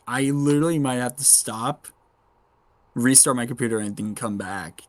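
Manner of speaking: ordinary talk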